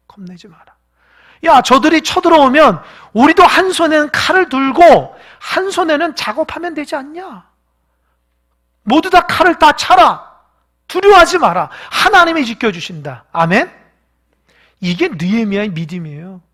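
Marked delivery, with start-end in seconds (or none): 0.1-0.7 hushed, breathy repeat of the command; whispered repeat. Acted. Preacher whispering Nehemiah's words to the congregation. 1.4-13.7 high, loud battle orders; rally the workers to take up swords; rousing command. Acted. Nehemiah shouting to the frightened builders, rousing command.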